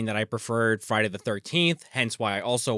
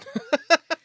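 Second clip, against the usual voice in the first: high giggle; 13 semitones higher than usual. Real, not acted. Laughing at cohost.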